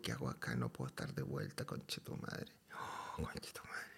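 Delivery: hushed, near-whispered panic under the breath; a quiet horrified aside; muttering to himself. Retold or imitated. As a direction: mutter it in dread to yourself. Inner panic on first day.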